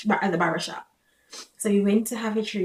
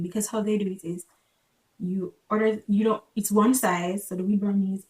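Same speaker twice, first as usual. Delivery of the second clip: normal talking voice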